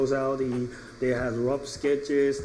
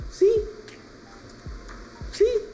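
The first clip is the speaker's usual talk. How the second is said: squeaky prompting. Pretend. Reviewer pointing out Snake to viewers, squeaky high prompting. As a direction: pipe it up high, playfully insistent.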